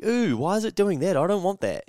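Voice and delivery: hammed-up high-pitched dismay; mock alarmed squeal. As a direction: squeal it like the bing startled you. Reacting to email bing.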